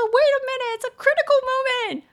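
high, loud strained voice with a laugh; straining friend in toilet. Retold or imitated. Friend calling from the toilet, high strained laughing voice. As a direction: squeak it out like you're mid-push. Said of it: "他说".

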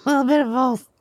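excited elf voice. Acted. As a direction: gush like an overexcited elf. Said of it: "you know me, Santa".

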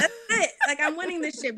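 high retold cry of triumph; excited. Player retelling her certainty of winning, excited. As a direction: burst out high, then ease slightly. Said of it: "I was just so excited".

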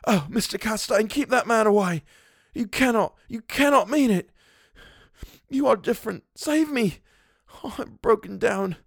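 very high, frantic pleading; crying and gasping. A narrator's reading. Vance on the floor begging Castaigne to save him, terrified. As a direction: beg for mercy, terrified and breaking. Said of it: "crying and grasping".

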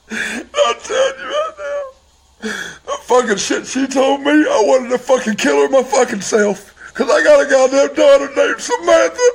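very high, anguished crying voice; real crying. Real, not acted. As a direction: cry the words out, nearly screaming. Breaks down naming his daughter.

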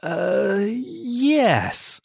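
high, wavering, unconvincing yes; squirming, high-voiced fib; hesitant lie. A narrator's reading. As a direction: stretch out an uncertain lie, pitched high. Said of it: "I lied".

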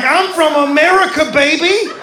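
high, loud, swaggering American boast; brash American pride. Retold or imitated. Comic boasting to his friend about America, loud swagger. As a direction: crow like a proud loud Yankee. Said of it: "I got real U S A".

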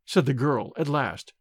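ordinary delivery, nothing marked

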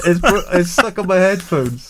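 muffled behind a cloth mask; talking through a homemade mask; through a face mask. Real, not acted. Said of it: "what I sound like with a mask on".

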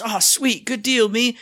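delighted high voice; chirpy high delight; giddy delight. Retold or imitated. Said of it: "And I was".

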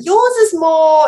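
imitating the driver with a Queens Bronx accent. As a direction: ham up the New York driver's accent. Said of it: "Queens Bronx accent".

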